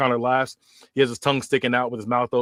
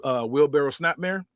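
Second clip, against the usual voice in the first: plain reading voice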